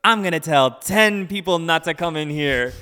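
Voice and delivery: imitated loud huffy threat; mock angry threat. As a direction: ham up the threat. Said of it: "And he goes".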